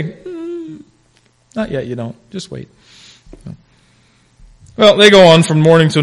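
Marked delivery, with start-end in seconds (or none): 0.0-2.7 calm unhurried reply. Retold or imitated. God gently answering the begging preacher, soft and calm. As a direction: answer like a patient God, quietly. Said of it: "I said".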